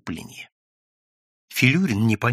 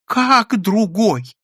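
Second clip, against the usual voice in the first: high, loud, slow indignant cry; shouting; 9 semitones higher, 5 dB louder, much slower than usual. A narrator's reading. Clerk crying out at losing his job, high and loud. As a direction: shout out in disbelief. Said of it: "закричал Прозрачный".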